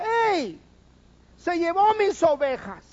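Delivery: high, loud indignant agreement; accusing shout. Acted. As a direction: back up the accusation loudly.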